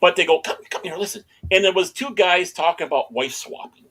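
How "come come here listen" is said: whispering. Retold or imitated. Coworkers calling him quietly.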